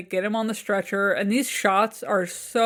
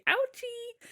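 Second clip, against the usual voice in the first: put-on squeaky high baby whine; a silly babyish cry of pain; mock ouchie; 14 semitones higher, 8 dB quieter than usual. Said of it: "I wanted him to be".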